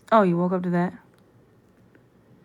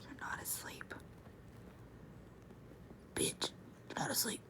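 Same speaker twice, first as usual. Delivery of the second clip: whispering; 11 dB quieter than usual. Real, not acted. Whispering to wake partner.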